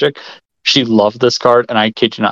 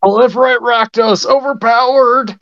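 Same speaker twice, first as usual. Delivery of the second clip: excited; 11 semitones higher than usual. Real, not acted. Hyping a combo among friends.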